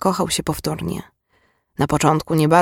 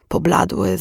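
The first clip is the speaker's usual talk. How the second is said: plain reading voice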